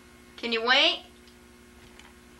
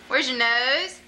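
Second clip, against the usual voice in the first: normal talking voice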